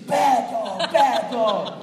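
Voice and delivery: mock owner scolding a dog. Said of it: "you say".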